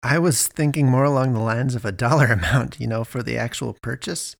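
usual speaking voice

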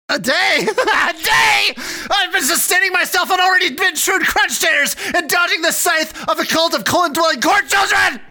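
frantic high-pitched yelling with laughter; manic shout. Acted. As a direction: scream it like a man gone mad. [name] erupts after repeating "a day.

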